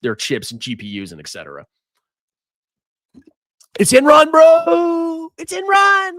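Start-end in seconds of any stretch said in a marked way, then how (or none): 3.8-5.3 shouted high and loud, ENRON punched; shouting. Real, not acted. Yelling about a stock collapse. 5.4-6.1 even higher, strained shout on ENRON. Real, not acted. Repeated yell about the stock.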